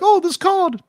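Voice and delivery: very high, squeaky British accent attempt. Co-host mimics naive Arthur in a squeaky British accent. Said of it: "hard to really pull that accent".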